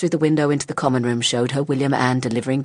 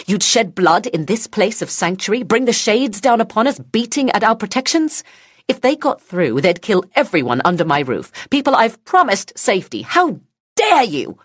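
furious shout; 10 semitones higher, 6 dB louder than usual. Acted. Woman rages at child.

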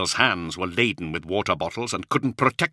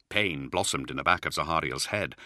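ordinary delivery

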